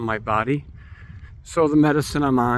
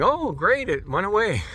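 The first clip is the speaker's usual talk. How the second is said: relieved; 8 semitones higher than usual. Retold or imitated. Relieved thought quoted to self.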